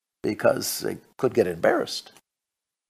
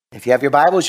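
ordinary delivery

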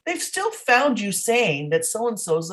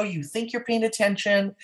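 usual speaking voice

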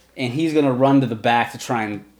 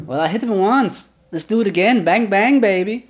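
higher, cocky taunt; cocky sorceress; 8 semitones higher than usual. Acted. Game master voices the sorceress taunting the bugbear, higher and cocky. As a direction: gloat as you point at him. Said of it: "she's gonna point her finger again".

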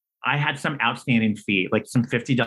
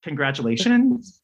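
taken aback; 6 semitones higher than usual. Retold or imitated. Caller taken aback by rude reply.

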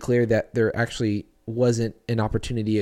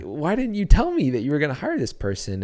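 a retold shocked cry that jumps far higher; acting out the disbelief, pitch shooting up on what; indignant surprise; 6 semitones higher than usual. To the church leaders about the new hire, a mock shocked outburst. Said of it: "and I was like".

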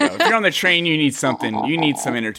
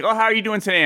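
higher, light casual greeting; imitated small talk; 8 semitones higher, faster than usual. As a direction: mimic a breezy how-are-you. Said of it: "and someone's like".

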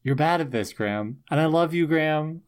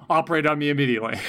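rattled off very fast, mock-eager; rushed mock demand; much faster than usual. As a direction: blurt it out fast like a sudden convert. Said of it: "And Riley would be like".

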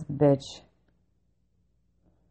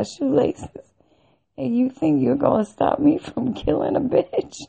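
high, amused scorn breaking into laughs; laughing mockery; 7 semitones higher, 3 dB louder than usual. Mocking staff for taking belt and shoelaces, laughing mockery. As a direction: retell it incredulously, higher, laughter bubbling through.

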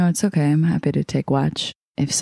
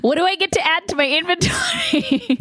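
excited. Real, not acted. Eager player question.

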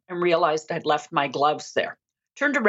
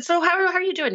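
bright mock-cheery greeting; 11 semitones higher, faster than usual. Cheerful greeting to paint clerk.

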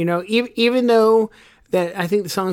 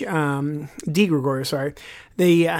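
usual speaking voice

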